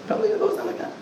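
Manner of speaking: high mocking voice. Shown as a demonstration. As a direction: squeak it up high in mockery. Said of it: "they're probably gonna close down the gun".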